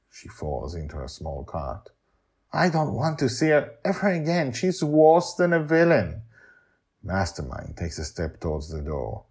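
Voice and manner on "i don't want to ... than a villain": put-on high, sulky child voice; sulky little girl. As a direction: pout like a cross kid. Joy's voice.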